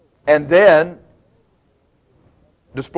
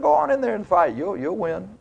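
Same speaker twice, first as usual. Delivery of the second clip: higher, softer mock encouragement; sarcastic; 4 semitones higher, 7 dB quieter than usual. Prophet tells the king what he wants, sarcastic. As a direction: feign a cheery send-off, sarcastically.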